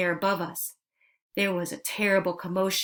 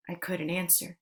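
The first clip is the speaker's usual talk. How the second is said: usual speaking voice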